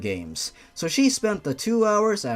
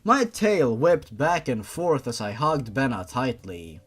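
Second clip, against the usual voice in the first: ordinary talk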